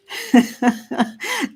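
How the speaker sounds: rising giggle at a funny name; a delighted little laugh; amused laugh. Real, not acted. Reading the name Vodka Witch, amused laugh.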